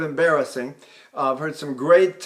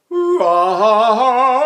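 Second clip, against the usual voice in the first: high ooh dropping to a louder ah; falsetto into chest; 5 semitones higher, 9 dB louder than usual. Shown as a demonstration. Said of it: "So we go".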